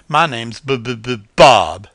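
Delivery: slow, loud stammer on B; stammering name. A narrator's reading. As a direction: drag out the B stammer. Possum stammers his name.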